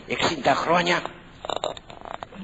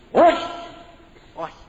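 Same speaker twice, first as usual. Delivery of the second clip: loud, high shouted word; acted firm refusal; 8 semitones higher, 5 dB louder than usual. Acts out the needed refusal. (δυνατή, κοφτή άρνηση)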